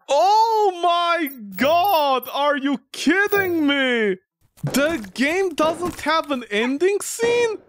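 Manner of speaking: high, slow shocked disbelief; disbelieving outcry. Real, not acted. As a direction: cry out in disbelief. Game broke, disbelief.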